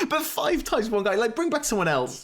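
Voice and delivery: high-pitched comic complaint; mock exasperation. Player begging the game for another enemy, exasperated.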